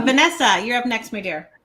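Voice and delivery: ordinary delivery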